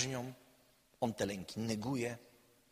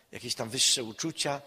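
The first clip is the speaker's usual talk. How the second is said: usual speaking voice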